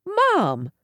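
sudden high alarm. A narrator's reading. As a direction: gasp it out in alarm. Sees mother near stalker.

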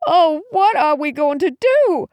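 high, distressed wail; mother's anguished high wail. A narrator's reading. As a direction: wail it out helplessly, pitch way up. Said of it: "Mom wailed".

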